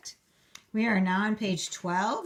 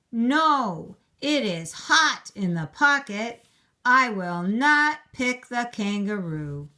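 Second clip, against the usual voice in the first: louder, higher, firm no; firm refusal; 3 semitones higher, 6 dB louder than usual. Acted. Princess turning down the kangaroo, loud and firm. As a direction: refuse flatly as the fussy princess.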